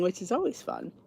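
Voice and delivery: ordinary talk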